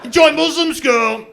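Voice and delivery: loud, high shouted send-off; hammed-up loud dismissive dad; mock dismissive dad. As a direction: shove them out the door with a yell. Dad shoving kids out.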